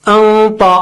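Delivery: انطا drawn out, full-mouthed and nasal; thick nasal ghunna. Shown as a demonstration. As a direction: hold a thick dark n before the ط of انطا. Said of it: "نون ساکنہ بھی بہت موٹا".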